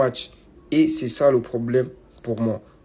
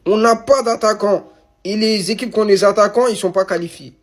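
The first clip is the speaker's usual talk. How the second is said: loud outburst; 9 semitones higher, 6 dB louder than usual. Real, not acted. Frustrated rant on African football.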